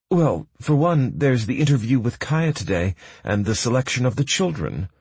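a hint of an eager young woman; Pearl's voice. A narrator's reading.

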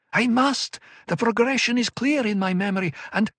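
high, urgent, pleading; desperate insistence; anguished young woman. Acted. Murbella pleads.

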